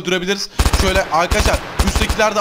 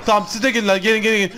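ordinary talk

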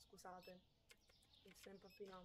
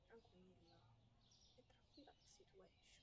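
whispered "È tranquilla la situation" softly; soft whispered self-reassurance; 13 dB quieter than usual. Real, not acted. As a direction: breathe it to yourself. Calming herself while working.

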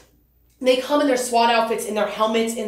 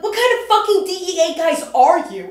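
higher and louder, incredulous scolding; mock outrage; 6 semitones higher, 4 dB louder than usual. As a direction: tear into him in disbelief. Retold scolding the DEA agent.

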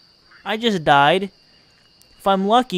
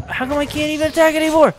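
frustrated whine; 11 semitones higher, faster than usual. Real, not acted. Frustrated at the game.

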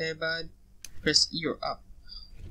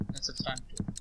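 hushed, tense whisper; 9 dB quieter than usual. Real, not acted. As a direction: breathe it out so nothing hears.

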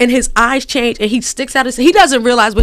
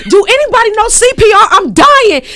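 high, loud panicked plea; frantic; 10 semitones higher, 6 dB louder than usual. Retold or imitated. Wife at ringside calling out for help, frantic and loud. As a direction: cry out for help, half-laughing. Wife overwhelmed after the fight.

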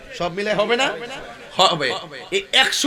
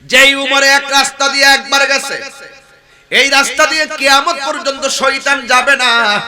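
loud, high chanted sermon delivery; full-force preaching; 8 semitones higher, 9 dB louder than usual. Real, not acted. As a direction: proclaim the story to a crowd. (উঁচু গলায় সুরেলা ওয়াজ)

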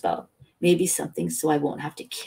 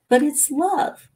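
pleading protest; 11 semitones higher, 5 dB louder, slower than usual. A narrator's reading. Lover protesting he is not tricked.

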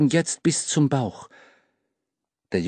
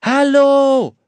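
loud, high drawn call; boy calls into the blizzard, loud; 13 semitones higher, 9 dB louder than usual. Acted. Lost boy calls loudly into the snowstorm. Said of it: "rief der Junge".

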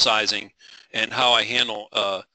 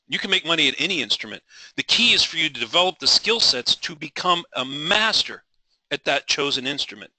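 ordinary talk, nothing marked

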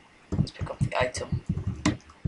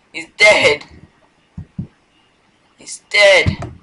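loud, higher shout of dismay; 6 semitones higher, 11 dB louder, slower than usual. Real, not acted. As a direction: yell it as the pilot dies. Character dies, shouting.

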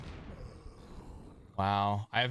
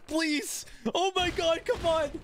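pleading. Real, not acted. Hoping for a roll.

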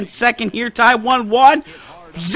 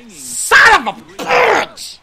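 slow, higher furious cuss; angry shout; 12 semitones higher, 4 dB louder, much slower than usual. Real, not acted. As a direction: yell it out in frustration. Frustrated at the game.

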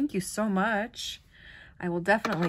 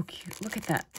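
whisper. Real, not acted. Whispered admiration.

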